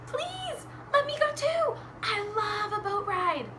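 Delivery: tiny high bunny voice; pleading bunny. Acted. Bunny asks Mister Gumpy for a ride, high pleading. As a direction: beg in a very high little voice. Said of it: "Bunny said".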